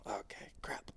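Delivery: breathless whispered curse; hushed unvoiced mutter of frustration. Diver whispering a curse to himself underwater.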